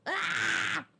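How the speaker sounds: sharp high yelp of pain; sudden pained cry. Acted. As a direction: yelp like you just got hit. Hit in battle.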